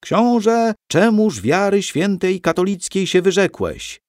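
high, loud accusing cry; stage shout. A narrator's reading. Young Krzysiek shouting his line at the prince. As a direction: call out like a child on stage. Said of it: "krzyknął po łacinie".

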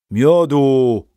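loud order for mead; calling out. A narrator's reading. Father calling loudly to the innkeeper for mead. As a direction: shout across the tavern. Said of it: "krzyknął do karczmarza".

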